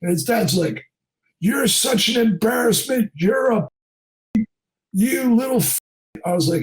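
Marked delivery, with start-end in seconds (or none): 1.4-5.8 mock yell, pitched up without extra volume; higher-pitched scolding, lightly acted; retold angry dad. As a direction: imitate a father berating his kid. Dad berating the kid, retold.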